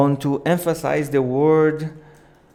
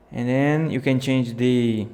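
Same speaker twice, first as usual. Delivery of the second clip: usual speaking voice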